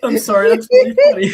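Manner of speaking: high-pitched helpless laughter, words squeezed out; laughing hard. Real, not acted. Laughing at a friend's accidental insult, cracking up. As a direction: laugh through the apology.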